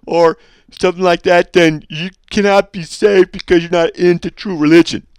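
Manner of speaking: high, loud, self-righteous put-on voice; smug sect member. Imitating false groups telling people they cannot be saved, high and loud. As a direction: play the zealot who insists on his church. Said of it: "that's how they talk when they talk like that".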